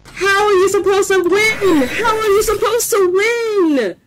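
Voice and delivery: high, strained desperate cry; desperate high wail. Real, not acted. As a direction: wail it out in despair. Desperate cry under attack.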